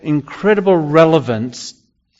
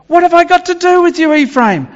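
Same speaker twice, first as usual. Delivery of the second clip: exasperated God; 12 semitones higher than usual. Retold or imitated. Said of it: "God is saying".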